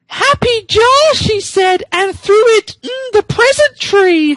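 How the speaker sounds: very high, slow, squeaky voice; very high voice for Amy Rose. Acted. Reading Amy Rose's quote.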